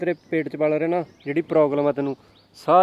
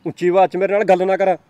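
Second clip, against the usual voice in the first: loud, higher contemptuous yelling; angry shout; 3 semitones higher, 6 dB louder than usual. Acted. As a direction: put her down with a raised voice. Boyfriend angrily insults girlfriend. (ਗੁੱਸੇ ਵਿੱਚ ਉੱਚੀ ਝਿੜਕ)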